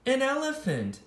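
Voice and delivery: ordinary delivery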